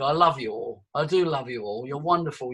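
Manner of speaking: low, soft affectionate aside; gentle, real fondness; warm sincerity. Real, not acted. Sincere aside thanking the listeners.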